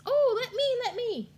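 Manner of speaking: high excited squeal; high-pitched pleading kid voice; eager state voice. Acted. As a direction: beg to go first, squeaky and high. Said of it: "And Colorado says".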